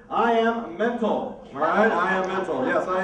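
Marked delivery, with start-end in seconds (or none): 0.1-1.4 drawn-out, showman's slow reveal; slow title announcement. Shown as a demonstration. Magician announcing his trick name, slowly and dramatically. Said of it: "I call this first trick".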